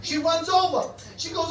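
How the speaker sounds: ordinary talk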